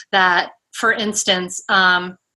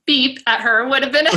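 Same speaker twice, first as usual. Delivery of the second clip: laughing; 5 semitones higher than usual. Real, not acted. Laughing at self-censored word.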